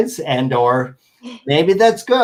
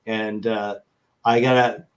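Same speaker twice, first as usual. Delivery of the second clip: normal talking voice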